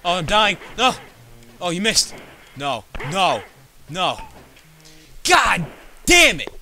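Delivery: loud, high yells at the game; real frustrated shouting. Real, not acted. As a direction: shout in panic as you keep losing. Losing and dying in game.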